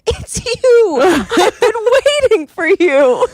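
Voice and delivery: high, loud, thrilled cry; excited recognition. Retold or imitated. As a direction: shout it out like a happy reunion. Friend recognizing her, retold.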